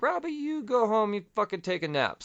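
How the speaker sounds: high-pitched voiced quote; mock bossy Avengers. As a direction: send someone home in a light, high voice.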